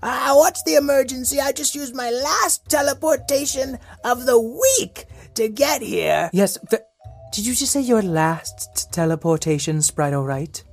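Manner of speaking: high, breathless sprite; fairy voice. Pretend. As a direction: play a little magical sprite. Sprite O'Wright voice.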